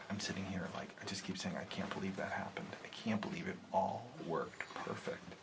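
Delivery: soft, disbelieving whisper. Real, not acted. Hunter whispering his disbelief to the camera. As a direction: whisper it low and shaking your head.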